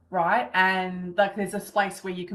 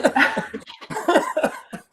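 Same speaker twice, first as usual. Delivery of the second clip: a breathy, moved laugh; teary laugh; 8 semitones higher than usual. Real, not acted. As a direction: laugh off being moved to tears. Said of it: "having a bit of a cry".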